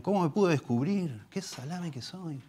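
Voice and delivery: high, mocking self-scolding whine; mock a sinner angry he got caught, not at the sin; preacher imitating a caught sinner, high and whiny; self-reproaching sinner.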